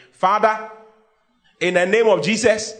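preacher launching into fervent prayer. Acted. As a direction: call out to the Father in prayer. Begins loud prayer.

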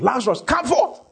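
high ringing call of command. Retold or imitated. Jesus calling Lazarus from the tomb, ringing command.

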